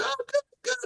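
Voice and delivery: squeaky high little mom voice, soft; mom's chirpy reply. Retold or imitated. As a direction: chirp it up high like a sweet old mother.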